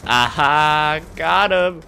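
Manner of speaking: high loud gloating whoop; triumphant cheer. Real, not acted. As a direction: crow over the kill. Gets a kill.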